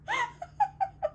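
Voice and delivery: high, squeaky fit of laughing; real laughing fit; helpless laughter. Real, not acted. Cracks up at own beans guess.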